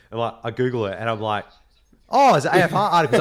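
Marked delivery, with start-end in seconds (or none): 2.1-3.2 excited disbelief. Retold or imitated. Reading the article, stunned.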